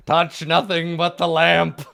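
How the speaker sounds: high, loud, slow genie voice; laughing through a genie line. Acted. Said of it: "He says".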